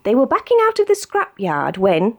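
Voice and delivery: ordinary delivery, nothing marked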